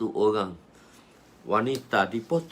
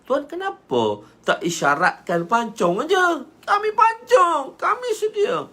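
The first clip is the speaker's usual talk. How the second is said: loud, very high exclamation; eager protest; 8 dB louder than usual. Acted. Companions ask why no signal was given, loud eager protest. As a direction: cry out as if ready to act at once.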